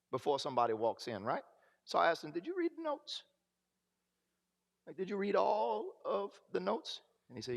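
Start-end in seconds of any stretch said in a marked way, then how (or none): 2.3-7.0 much higher, disbelieving did you read the notes; incredulous question. Retold or imitated. Scoffing at the counselor who skipped the notes, high disbelief. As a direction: ask it half laughing, not believing him.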